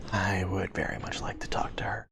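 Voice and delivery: low, hushed, near-whispered answer; quiet in-character request. Acted. Character asks to see the prisoner, hushed low voice. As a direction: ask softly and low, in character.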